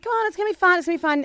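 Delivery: jolly them along, a bit hammed-up; mock cheerful mum. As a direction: mum coaxing reluctant kids, high and chirpy. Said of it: "I've just been like".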